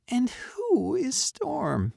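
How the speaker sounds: high, slow cat voice; meowing cat. A narrator's reading. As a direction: meow the question unhurriedly. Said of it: "she meowed".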